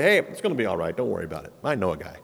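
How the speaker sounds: low, soft, breezy brush-off; lazy dismissive reassurance, dropped low and quiet; mock casual shrug. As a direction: toss it off like nothing matters. God shrugging off sins, mocked.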